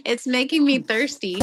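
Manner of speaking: ordinary talk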